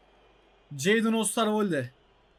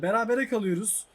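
ordinary talk

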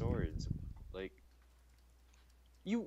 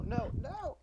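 panicked shout; 14 semitones higher than usual. Real, not acted. Game loss, rising panic.

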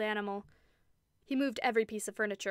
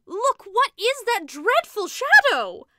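frightened cry; 12 semitones higher, 15 dB louder, slower than usual. A narrator's reading. Sister terrified by the shadow.

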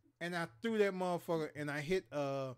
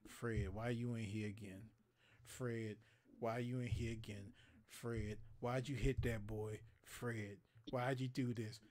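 lower, softer, slow tired scold; weary principal; 6 semitones lower, 8 dB quieter than usual. Retold or imitated. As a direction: sigh it out like a fed-up principal. Principal scolding student again.

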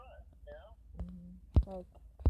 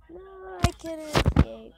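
panicked cry; 5 semitones higher, 9 dB louder, slower than usual. Pretend. Being chased in game.

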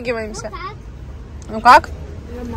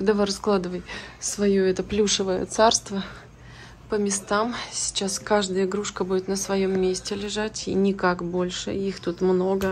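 quiet bedtime voice; 10 dB quieter than usual. Real, not acted. Putting the kids to bed.

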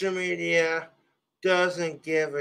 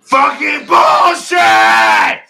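much higher, much louder, slow outburst; slow furious shout; 7 semitones higher, 12 dB louder, slower than usual. Real, not acted. Streamer after spilling his drink, slow furious roar. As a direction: roar it out slowly in rage.

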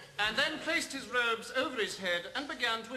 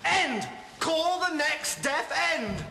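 slow, loud and higher court cry; usher's call; 6 semitones higher, 4 dB louder, slower than usual. Acted. Usher shouting for the next defendant, slow and loud. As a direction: bellow it down the courtroom.

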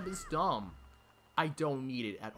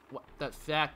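ordinary talk, nothing marked

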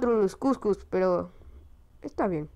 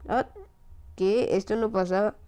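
ordinary delivery

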